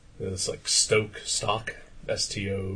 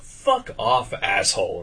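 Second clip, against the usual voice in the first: retold angry retort; 5 dB louder, slower than usual. Customer turning round on the heckler, acted angry retort. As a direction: re-enact snapping back at a heckler.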